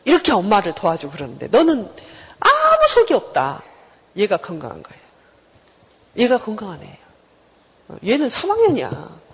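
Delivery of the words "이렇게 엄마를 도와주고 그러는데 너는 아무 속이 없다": nagging mother. Acted. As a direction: scold your child by comparison, stretching the final jab. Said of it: "이 집 엄마가 막 뭐라 그래요".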